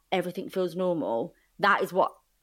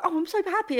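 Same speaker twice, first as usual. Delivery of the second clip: much higher, lightly chirpy imitation of herself; mock happy; 10 semitones higher than usual. Guest imitating her own up moments, a mock happy chirp. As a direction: toss it off like a breezy happy moment. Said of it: "I'm like".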